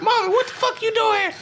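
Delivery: hammed-up startled woman. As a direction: squeal it like a spooked woman. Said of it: "She's like".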